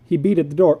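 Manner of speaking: usual speaking voice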